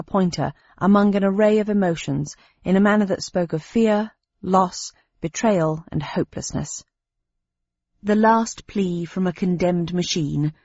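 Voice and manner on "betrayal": plain reading voice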